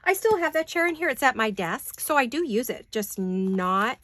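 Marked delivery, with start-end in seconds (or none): none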